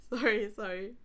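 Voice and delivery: high, loud, laughing outburst; laughing apology. Real, not acted. Interrupting the reading to confess a cursed mind, laughing. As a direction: apologise through real giggles.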